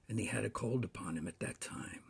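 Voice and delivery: ordinary delivery, nothing marked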